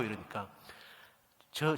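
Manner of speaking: usual speaking voice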